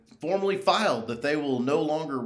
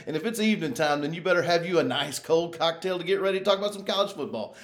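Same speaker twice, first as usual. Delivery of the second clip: ordinary talk, nothing marked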